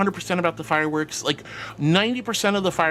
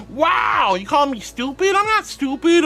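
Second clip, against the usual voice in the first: mock offended; 10 semitones higher, 5 dB louder than usual. Chatter jokingly calls her stupid.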